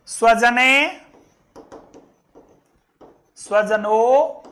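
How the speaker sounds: slow Sanskrit recital with long pauses; drawn-out words, set apart; word-by-word verse recitation. Shown as a demonstration. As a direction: give out each word separately for learners.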